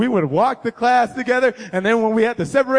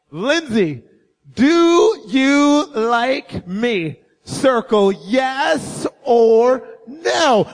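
very slow, drawn-out words as he writes; slow note reading; much slower than usual. Acted. Teen boy reading his note aloud as he writes it, slow and drawn out. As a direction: spell out each word of the love note slowly.

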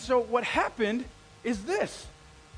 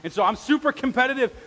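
ordinary talk, nothing marked

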